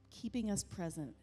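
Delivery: ordinary talk, nothing marked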